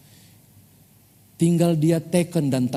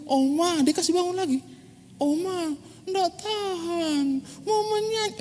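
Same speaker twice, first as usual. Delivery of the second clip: put-on whiny child; 3 dB quieter than usual. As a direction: beg urgently like a small kid. Child pleading to grandma again.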